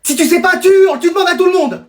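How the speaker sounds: high, over-urgent advice; frantic instructing. Acted. Parent briefs child for airport, frantic. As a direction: drill it in with panicky energy.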